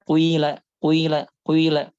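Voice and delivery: kuila slowly, a brief u gliding into long i; slow kuila, keep the dhammah tiny; correct ishmam, slow. Shown as a demonstration. Teacher slowly drilling the correct kuila. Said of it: "dhammahnya lebih sedikit daripada harokat kasroh".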